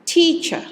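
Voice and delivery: "Teacher" said high with the first syllable TEA-cher stressed. Shown as a demonstration. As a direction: land hard on TEA in "Teacher". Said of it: "the stress is in the first syllable".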